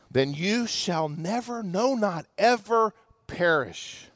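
hushed, slow whisper; preacher whispering the promise to the congregation. Acted. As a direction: breathe "never, not ever perish" softly and slowly.

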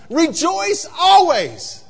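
loud, slow, high proclamation; proclaimed verse. Acted. Pastor proclaims the verse to the church, loud and slow. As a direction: ring out the words Rejoice always.

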